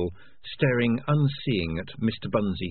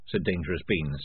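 ordinary delivery

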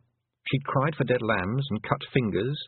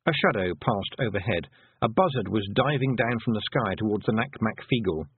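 usual speaking voice

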